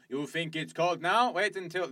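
jokey Eastern European villain, drawn out; hammed-up game villain threat; mock Russian accent. Friend mocking a game guard, in a mock Russian accent. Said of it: "doing all these voices in, like, um, some sort of Russian accent".

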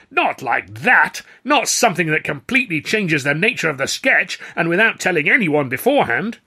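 loud, high, emphatic outrage; furious rebuke. A narrator's reading. Star scolding over a changed sketch, furious. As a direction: hammer it home, loud and indignant.

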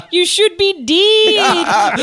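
slow, high, loud Scottish shout; Scottish accent. Retold or imitated. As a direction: bellow it like a Scottish local. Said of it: "in a Scottish accent".

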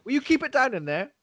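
high, shouted complaint; yelling through walls. Pretend. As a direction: yell at the noisy neighbour. Yelling at noisy boy in walls.

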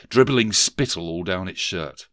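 usual speaking voice

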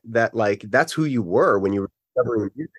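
ordinary talk, nothing marked

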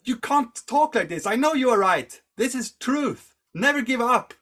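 high, loud acted outburst; vehement exhortation. Monk's acted conflicted outburst.

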